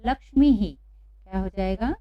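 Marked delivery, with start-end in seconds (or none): 0.0-0.8 "लक्ष्मी ही" as one word, ending on a voiced ही; higher, louder model of "लक्ष्मी ही"; visarga demonstration. Shown as a demonstration. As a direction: echo the long ई after ह in "लक्ष्मी ही". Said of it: "उच्चारण भी ही का ही".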